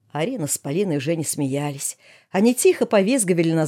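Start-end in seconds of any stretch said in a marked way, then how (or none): none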